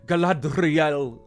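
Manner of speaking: Galadriel with an exaggerated rolled R; hammed-up trilled R. Mocking the actress's ham-fisted accent, hammed-up trilled R. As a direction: roll the R in Galadriel hard. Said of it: "you can trill the R".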